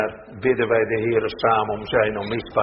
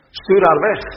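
louder, higher, impatient command; urgent demand; 8 semitones higher, 6 dB louder than usual. Acted. Disciples urge Jesus to send the crying woman away, sharp and loud. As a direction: push him to send her off.

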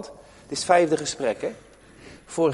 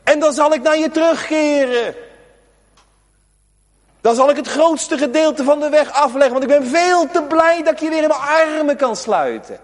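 higher, louder, overjoyed plea; God's joyful call; 10 semitones higher, 5 dB louder than usual. Acted. God tells the returning sinner he runs to meet him, higher and louder. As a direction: call out with open arms. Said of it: "Dan staat er toch".

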